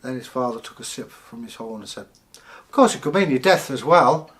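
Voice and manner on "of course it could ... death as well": father's voice jumps higher and louder on "Of course"; a hammed-up doom-laden aside, loud; loud ominous warning. Father warns Bragi the dragon could kill him, loud and dramatic.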